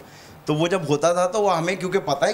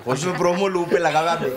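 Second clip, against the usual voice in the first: laughing; 3 semitones higher than usual. Real, not acted. Joke about the DVD promo.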